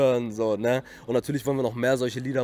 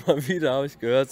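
ordinary talk, nothing marked

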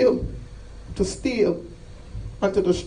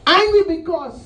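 high, raised shout; impassioned preaching cry; 8 semitones higher, 4 dB louder than usual. Acted. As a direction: cry out the refrain. Preacher cries out refrain of anger.

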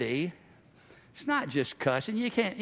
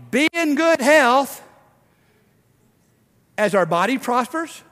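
louder and higher, building to a preacher's shout; full-force preaching; 6 semitones higher, 6 dB louder than usual. Acted. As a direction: preach it hard, rising to a cry.

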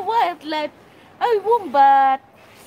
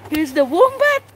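ordinary delivery

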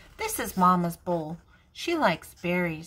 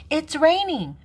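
put-on child voice; 8 semitones higher, 7 dB louder than usual. Child voice, second take.

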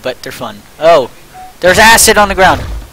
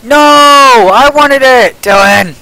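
loud drawn-out protest; frustrated outcry; 8 semitones higher, 7 dB louder, much slower than usual. Real, not acted. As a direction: whine loudly at your friend. Friend grabbed the revolver.

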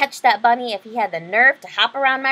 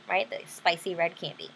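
plain reading voice

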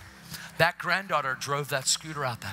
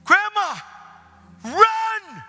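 put-on high, loud child's yell to Grandma; acted excited shout; 11 dB louder, much slower than usual. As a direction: call out at full voice, urging her on.

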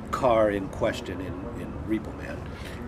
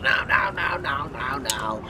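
English director; 3 semitones higher, slower than usual. Retold or imitated. Director interrupts actor's audition, mock English director. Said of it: "string bean English guy".

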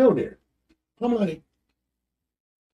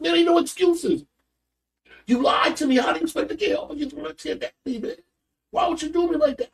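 retold scolding; 13 semitones higher, faster than usual. Father scolds son.

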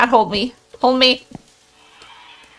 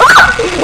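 1 shocked high laugh; 11 semitones higher, 10 dB louder than usual. Real, not acted. Reacting to the scare.

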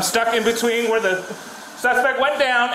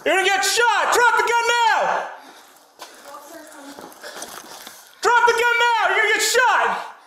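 repeated police yell, much higher; shouting commands; 7 semitones higher, 3 dB quieter than usual. Real, not acted. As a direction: bark it again and again. Police shouting at armed suspect.

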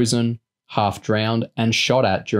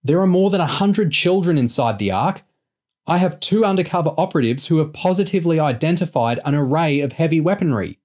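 urgent high-pitched insistence; raised voice, strained to be heard; shouting; 8 semitones higher than usual. A narrator's reading. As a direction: press the warning down a bad phone line. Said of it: "Chloe shouted".

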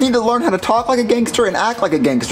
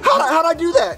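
amazed shout; 10 semitones higher than usual. Acted. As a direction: shout it in disbelief at your own shot. Amazed at hitting bullseye.

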